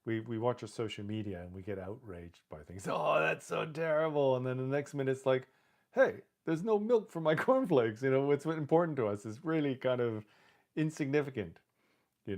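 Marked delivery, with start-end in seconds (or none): 2.9-4.4 high, slow, put-on gasp of horror; mock outrage. Mocking social media users with fake outrage. 5.9-8.0 high, louder petty complaint; mock whine. Mocking people fussing over cornflakes after outrage.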